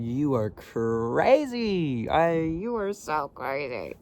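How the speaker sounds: slow, drawn-out playful disbelief; delighted teasing. Pretend. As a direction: stretch it out, laughing at her. Teasing Linda about her order.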